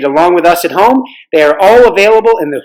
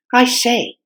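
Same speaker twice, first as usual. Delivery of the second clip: put-on young girl; 8 semitones higher, 3 dB quieter than usual. Girl voiced in story.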